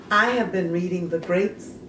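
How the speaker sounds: ordinary talk, nothing marked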